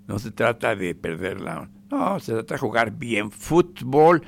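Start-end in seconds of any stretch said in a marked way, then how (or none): none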